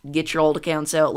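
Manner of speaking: usual speaking voice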